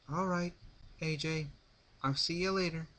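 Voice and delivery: a hint of a brash young flier. A narrator's reading. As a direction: brush off the refusal, a touch cocky. Rainbow's farewell.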